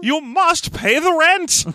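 very high, slow villain sneer; sneering panto villain. Acted. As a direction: demand the rent with relish. Classic melodrama landlord line.

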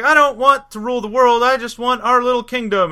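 high-pitched mock pleading; imitation of a whining wife, high. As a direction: plead in a put-on woman's voice. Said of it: "and she's like".

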